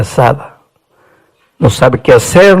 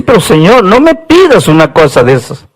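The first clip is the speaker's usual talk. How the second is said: tearful pleading; 10 semitones higher than usual. Acted. Prophet begging God not to ask again, tearful plea. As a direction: beg the Lord through tears. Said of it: "el profeta llorando le dice".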